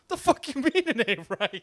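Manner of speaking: high, loud incredulous laughing retort; laughing mock outrage at the golfer. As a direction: fire it back half-laughing in disbelief. Caddie's retold outraged reply.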